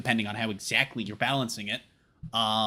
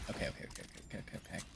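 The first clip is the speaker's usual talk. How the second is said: whispered okays; 7 semitones lower, 20 dB quieter, much faster than usual. Real, not acted. Muttering to self.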